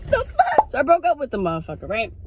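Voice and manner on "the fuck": very high, baffled outburst; high-pitched incredulity; squeaky disbelief. Acted. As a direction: squeal it in comic shock. Reaction to the shadow figure.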